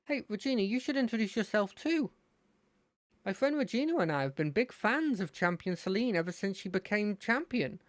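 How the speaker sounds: high-pitched eager fan; Terry. Acted. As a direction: gush like an excited young fan. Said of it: "to get me into his voice".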